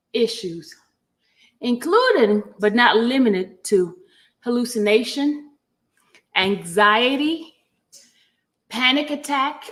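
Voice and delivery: slow, deliberate read-aloud; formal document reading. Real, not acted. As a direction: read a legal motion out item by item.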